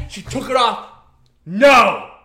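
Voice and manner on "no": sharp no. Retold or imitated. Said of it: "And she fucking says".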